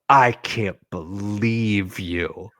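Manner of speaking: slow, low mock indignation; retold disbelief. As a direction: draw it out, low and accusing. Angry girl confronts him.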